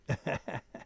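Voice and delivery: short real chuckle; a brief string of laughs; laughter. Real, not acted. Streamer chatting about England's defeat, short laugh. Laughs after football remarks.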